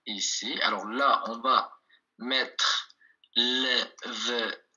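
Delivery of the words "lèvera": very slow, separated syllables in "lèvera". Shown as a demonstration. Teacher writing the word out for learners, "lèvera" syllable by syllable. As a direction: stretch out "lèvera" one syllable at a time.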